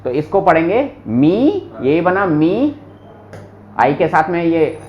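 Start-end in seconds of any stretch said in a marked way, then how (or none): none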